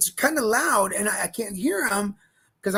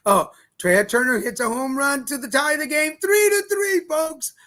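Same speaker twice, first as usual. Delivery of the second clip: rising, louder announcer call on the tie; excited home run call; 5 semitones higher, 4 dB louder than usual. Acted. Host announces the tying home run to listeners, excited call. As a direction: call the homer like a ballpark announcer.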